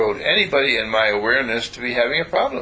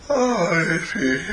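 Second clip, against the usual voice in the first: said through a yawn, higher and slow; 6 semitones higher, much slower than usual. Shown as a demonstration. So relaxed he yawns the mantra, yawning. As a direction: stretch the words out in a big yawn. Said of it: "And I'm yawning".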